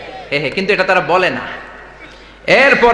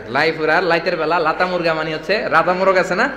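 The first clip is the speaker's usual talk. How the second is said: normal talking voice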